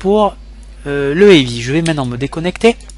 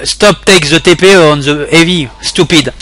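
a bit louder, slow mocking read of an insult; rude chat message; 3 semitones higher, 7 dB louder, slower than usual. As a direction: read the rude English chat line aloud with scorn. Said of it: "Ça aussi, c'est pas très poli". (lecture moqueuse d'un message impoli)

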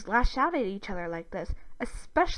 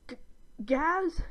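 hesitant, higher-pitched questioning call; nervous call; 8 semitones higher than usual. A narrator's reading. Brother calling for his sister into darkness, nervously. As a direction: stammer the name out timidly. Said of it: "Dib nervously calls".

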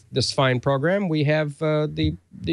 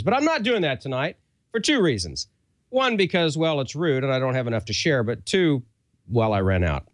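upbeat, pitched-up sponsor pitch; ad read; 5 semitones higher than usual. Acted. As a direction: sell the snacks like a host reading a live ad.

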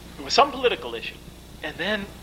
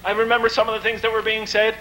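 ordinary talk

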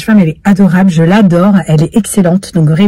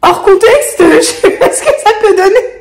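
much higher, laughing quote; 10 semitones higher than usual. Retold or imitated. Both women joking about the situation, laughing much higher. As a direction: say it while cracking up. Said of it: "On se dit".

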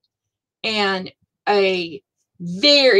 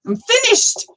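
triumphant; 13 semitones higher than usual. Real, not acted. Announces completed project.

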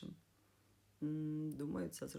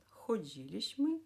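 «ходілішми» stressed on «ді», raised and louder; stress third from end; 5 semitones higher, 5 dB louder than usual. Shown as a demonstration. Teacher gives past-tense example, stressing «ді» in «ходілішми». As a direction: punch «ді» in «ходілішми». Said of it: "коли наголос падає на третій склад".

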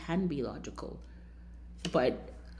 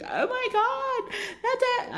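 much higher, slow, imitating a shocked gasp; shocked friend; 3 dB louder, slower than usual. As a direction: play the friend spotting the viper. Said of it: "She's like".

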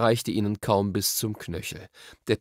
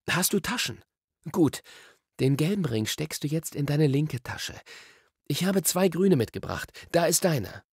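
put-on higher boy-character voice, instructing; Diggory, confident; 7 semitones higher than usual. A narrator's reading. Diggory instructs Polly about the rings, confident. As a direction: explain briskly as the boy with the answer.

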